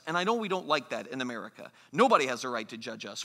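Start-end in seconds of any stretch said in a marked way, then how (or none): 1.9-3.2 quick, lower mock protest; dismissive retort. Preacher voices Americans rejecting judgment, quick dismissive retort. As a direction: rattle it off as a defiant objection.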